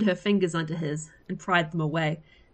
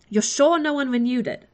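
urgent; 7 semitones higher, 4 dB louder than usual. A narrator's reading. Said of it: "Orpheus said urgently".

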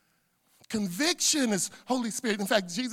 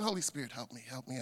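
muttered prayer; 7 semitones lower, 9 dB quieter, faster than usual. Acted. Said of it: "let it be saying things like".

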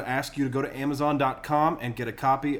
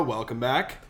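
normal talking voice

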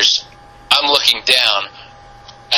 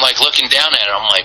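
ordinary delivery

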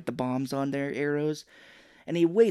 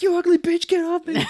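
very high, loud panicked yell; mock hero yelling at an attacker on his back; Ash; 8 dB louder than usual. Ash with Sheila on his back, high loud mock yell. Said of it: "he does, he's like".

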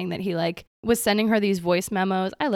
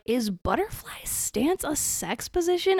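slow, higher puzzled query; mock naive question; 5 semitones higher, 4 dB quieter, slower than usual. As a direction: ask it like a baffled newcomer. Quoting own naive question.

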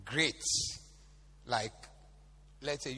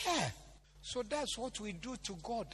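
ordinary talk

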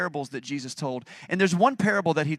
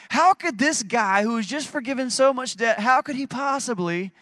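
high-pitched, emphatic outrage; indignant disbelief; 7 semitones higher, 3 dB louder than usual. Acted. Preacher questions the forgiven servant's demand, indignant. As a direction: push the question high, appalled.

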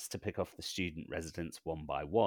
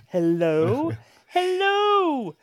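very high, loud calling voice; film character impression; 13 dB louder, much slower than usual. Host repeating the film impression for co-hosts, high hammed-up calling. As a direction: shriek it out in a hammed-up mimicry. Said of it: "you did a very good impression".

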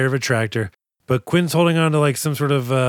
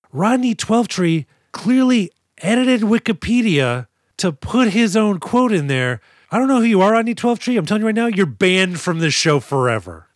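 mock outrage; 6 semitones higher than usual. Podcaster banning a reviewer from the show, mock-outraged rant. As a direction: build an emphatic case, then pronounce the ban.

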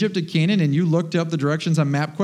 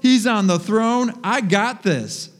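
high, triumphant mock bravado, slowing at the end; cocky post-sermon swagger, a touch hammed-up; pumped-up churchgoer; 6 semitones higher, much slower than usual. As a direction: play the fired-up believer after church. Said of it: "walked out of a church service and be like".

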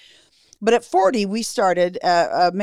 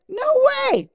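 put-on much higher, a child's stunned "no way"; kids' disbelief. Her kids hearing that mom has cancer, high childlike disbelief. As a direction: squeak it like a shocked kid.

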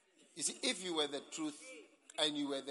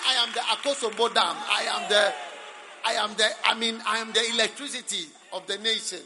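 high, loud shouted bragging; mock grandiose claim; 5 semitones higher, 10 dB louder than usual. Preacher mocking a man claiming to be the dam, loud mock bragging. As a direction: shout it like an absurd boaster. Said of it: "It's like saying".

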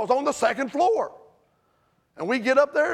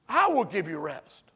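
soft echo; 6 semitones lower, 4 dB quieter than usual. Acted. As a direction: lower and softer "I will give you rest", let it settle. Preacher echoes the promise softer.

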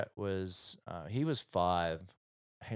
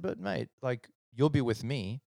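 higher-pitched pleading dad; exasperated coaxing; 6 semitones higher, 4 dB louder than usual. Retold or imitated. Dad offering son help with homework, exasperated coaxing. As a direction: reason with him, voice lifted. Retells reasoning with reluctant son.

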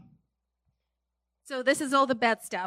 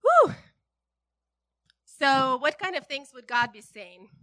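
usual speaking voice

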